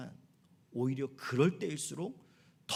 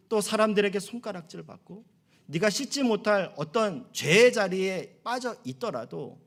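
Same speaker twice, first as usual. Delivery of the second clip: normal talking voice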